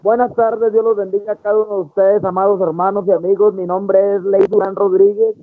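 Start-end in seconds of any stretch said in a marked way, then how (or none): none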